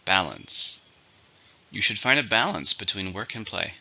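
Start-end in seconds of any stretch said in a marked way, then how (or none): 0.1-0.8 BAL-ance, first syllable strong; stress on first syllable. Shown as a demonstration. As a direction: model the word "Balance" with weight on BAL. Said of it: "stress on the first syllable".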